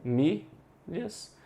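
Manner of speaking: Famílias stretched out very slowly; teacher slowly sounding out Famílias; slow syllables. Shown as a demonstration. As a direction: draw out Famílias slowly and softly. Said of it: "Eu tenho aqui famílias".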